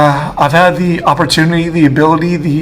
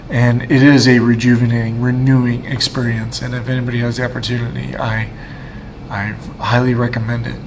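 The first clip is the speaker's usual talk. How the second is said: usual speaking voice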